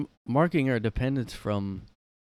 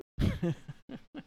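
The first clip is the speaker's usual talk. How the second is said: high laugh; 6 dB quieter than usual. Retold or imitated. Squeaky laugh after impression.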